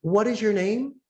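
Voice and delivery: high, bright and curious, rising at the end; cheerful receptionist asking the caller's name; friendly question. Retold or imitated. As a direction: ask "What is your name?" warmly, lifting on "name". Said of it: "might have a negative tone".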